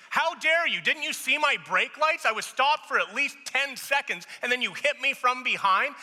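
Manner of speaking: high, loud indignant shout; angry outburst. Acted. Acting out a driver confronting whoever rear-ended them, angry shouting. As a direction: explode in outrage at the other driver. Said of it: "you probably have that fight indicator come out".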